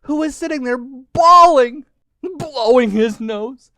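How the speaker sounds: high, loud wailing narration; hammed-up weepy storytelling; mock bawling. A narrator's reading. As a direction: stretch the words out like exaggerated sobs. Narrator mimics the lizard's bawling.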